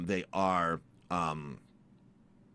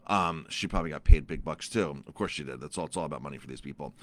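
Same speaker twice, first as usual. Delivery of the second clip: ordinary talk, nothing marked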